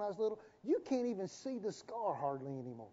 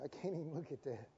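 mock disgust, quiet; 4 semitones lower, 11 dB quieter than usual. God recoiling from the person.

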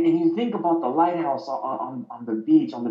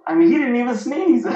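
laughing; 9 semitones higher, 6 dB louder than usual. Real, not acted. Joke punchline, laughing.

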